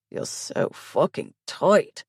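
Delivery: slow, strained grunt; a mild, understated grunt. A narrator's reading. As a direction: grunt it out slowly. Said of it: "he grunts".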